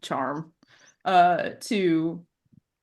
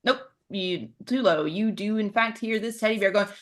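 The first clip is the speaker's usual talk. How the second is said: ordinary talk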